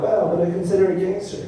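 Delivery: lightly voiced impressed self; mock impressed. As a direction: act pleasantly impressed. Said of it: "I'd be like".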